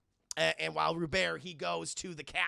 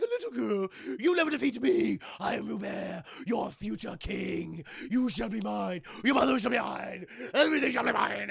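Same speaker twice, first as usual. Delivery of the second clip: loudish villain boast, lightly voiced; hammy villain taunt; 4 dB louder than usual. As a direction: sneer down at the girl. Villain taunts Kaylee in duel.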